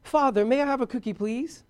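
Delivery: high, quick mock-formal kid; overly polite child. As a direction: ask father with exaggerated good manners. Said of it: "Because they speak like that".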